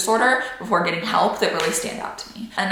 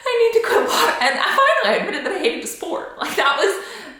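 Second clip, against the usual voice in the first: laughing; 10 semitones higher than usual. Real, not acted. Laughing about hating water polo.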